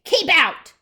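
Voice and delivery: high, very loud warning yell; Hank. Acted. Cactus chasing off a tortoise, yelled. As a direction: yell it like an order to intruders. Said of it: "yelled Hank".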